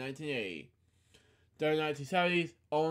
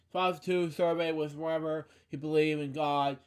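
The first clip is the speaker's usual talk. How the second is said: ordinary talk, nothing marked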